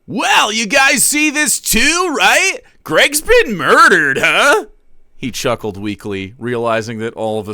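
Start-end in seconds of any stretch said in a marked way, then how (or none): 0.1-4.7 high, louder, slow forced casual tone; a higher, louder uneasy streamer voice; nervous streamer voice. Acted. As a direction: play it off to chat, higher and slow. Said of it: "Okay, this is in my voice".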